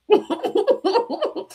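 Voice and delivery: a burst of high-pitched laughter. Real, not acted. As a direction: crack up at yourself. Laughing at her own anger.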